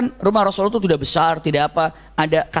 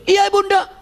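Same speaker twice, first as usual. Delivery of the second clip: high, called-out answer to Mother; acted son hollering back; shouted reply; 13 semitones higher, 3 dB louder than usual. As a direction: yell it back from another room. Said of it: "jawabannya teriak dan itu salah".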